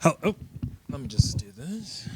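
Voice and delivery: murmured under the breath while fiddling; a quick private aside, barely voiced; whispered aside. Real, not acted. Aside while setting up mic.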